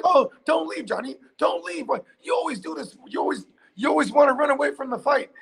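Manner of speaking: high whiny mock plea; mock pleading. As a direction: whine like a clingy wife. Mock pleading Amber on recording.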